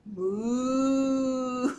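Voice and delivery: drawn-out playful moo; cow moo. Shown as a demonstration. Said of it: "Let me hear ya".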